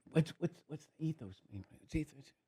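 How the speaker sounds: quiet, baffled mock voice; half-whispered bewilderment; confused questioner. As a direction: play dumb, puzzled. Mocking a confused person.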